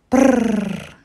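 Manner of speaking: loud mock shivering; pretend shiver brrr. A narrator's reading. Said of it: "said the little princess".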